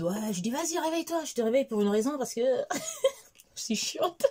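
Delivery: giggling at her own mischief; laughing while quoting. Retold or imitated. Raconte avoir réveillé son mari. (en riant de sa propre bêtise)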